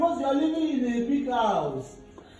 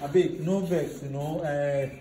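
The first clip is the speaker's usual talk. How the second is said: lower, gentle placating voice; smooth it over with the guest; soft apology; 8 semitones lower, 5 dB quieter than usual. Acted. Brother apologizes softly to visitor.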